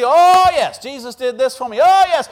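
high loud joyful oh yes; testimony praise. Retold or imitated. Churchgoer testifying at service, high and loud. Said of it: "The first two would be".

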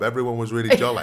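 laughing. Real, not acted. Retelling the giggly glue job.

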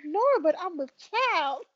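high, slow, laughing quote; drawn-out mock child claim; imitated movie character. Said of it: "She was like".

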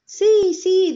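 high, loud eager yes; excited yes. A narrator's reading. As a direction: jump in eagerly. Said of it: "dicen las pavas". (sí entusiasta, agudo y fuerte)

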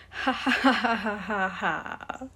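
loud run of ha-has, falling in pitch; hearty fake laugh. A narrator's reading. The bow after introducing itself, theatrical laugh.

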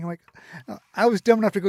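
ordinary talk, nothing marked

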